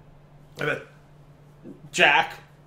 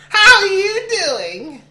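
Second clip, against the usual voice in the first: very high, loud, slow squeal; strained high voice; 10 dB louder, much slower than usual. Pretend. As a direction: stretch it out high through a laugh. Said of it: "Don't, don't hurt yourself".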